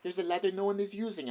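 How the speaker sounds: high, quick cheeky voice; student snatching the ladder with a grin. A narrator's reading. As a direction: toss it off brazenly. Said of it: "he said".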